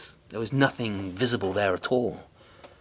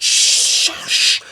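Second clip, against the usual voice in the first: shushing; 9 dB louder than usual. A narrator's reading. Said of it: "He said".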